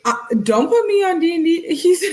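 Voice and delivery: high and loud, laughing through it. Real, not acted. Streamer laughing at a chat comment, high and loud. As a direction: giggle the words out.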